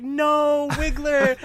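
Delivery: high, loud, drawn-out wail; mock anguished cry. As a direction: cry out in playful despair. Everyone's cry as Wiggler seems dead.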